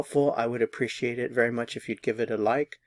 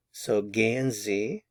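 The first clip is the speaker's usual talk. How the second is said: ordinary talk, nothing marked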